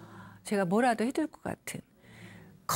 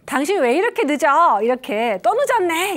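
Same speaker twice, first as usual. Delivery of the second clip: loud, higher angry scolding; nagging spouse; 5 semitones higher, 8 dB louder than usual. Retold or imitated. Spouse scolding a partner who came home late, loud and angry. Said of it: "부부 상담도 하는데".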